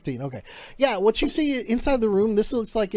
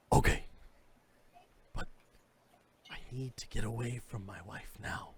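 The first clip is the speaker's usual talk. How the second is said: low, much softer, hushed begging; quiet plea; 6 semitones lower, 14 dB quieter than usual. Acted. Informant quietly begging the guard to get him away. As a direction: hush it, scared of being overheard. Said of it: "he looks at you and goes".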